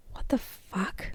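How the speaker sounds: soft, slow, breathy whisper of shock; a stunned whisper under the breath; whispered disbelief. Real, not acted. Thought kept to herself.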